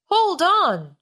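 loud, high command; shouted. A narrator's reading. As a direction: bark the order to hold tight. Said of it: "shouted Sailor Sid".

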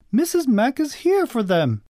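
high-pitched mother's question; high storybook mother voice; demanding mother. A narrator's reading. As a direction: call out a demand, pitched well up.

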